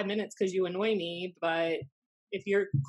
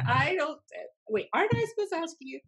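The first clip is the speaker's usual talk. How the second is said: higher, quick and flustered; flustered, higher-pitched confusion; confused surprise; 9 semitones higher than usual. Retold or imitated. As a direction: caught off guard, rushing the question. Confused retold reply, higher, fast.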